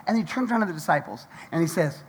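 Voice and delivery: usual speaking voice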